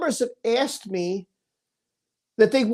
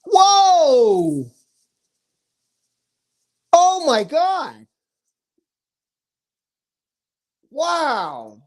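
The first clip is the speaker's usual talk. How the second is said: stunned, with long gaps between exclamations; real amazement; 2 semitones higher, 6 dB louder, much slower than usual. Real, not acted. Reacting to surprise donations live.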